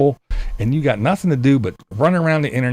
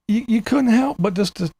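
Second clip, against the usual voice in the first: ordinary talk, nothing marked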